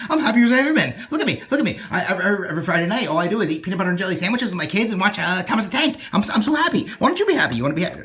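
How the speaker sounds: very high, loud, laughing manic boasting; crazy psycho parent. Acted. Unhinged parent boasting about family Friday nights, laughing and shrill. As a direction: cackle through it like a parent coming apart. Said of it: "a crazy psycho parent".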